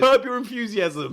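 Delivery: high, loud, drawn-out telling-off; amused loud reprimand, stretched out; teasing scolding. Real, not acted. Teasing co-host who nearly did it.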